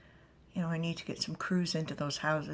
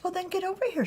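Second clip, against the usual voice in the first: higher, louder playful call; high teasing call; 4 dB louder, faster than usual. Acted. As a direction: call her over with a grin. Figure teasing loudly.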